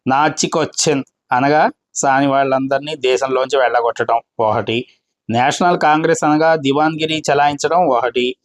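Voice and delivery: ordinary talk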